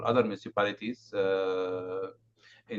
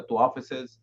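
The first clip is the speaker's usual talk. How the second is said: louder, slow and clear; speaking up; 4 semitones higher, 5 dB louder, slower than usual. Real, not acted. Presenter repeating the facility list after a complaint, speaking up. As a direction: project it slowly for the listeners. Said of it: "just speak a little louder".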